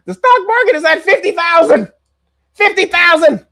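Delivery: high, loud, hammed-up mock boast; mocking Bondi's rant. Streamer mimicking Bondi bragging about stocks, high mock voice. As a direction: squeal it like a gloating official. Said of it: "She said".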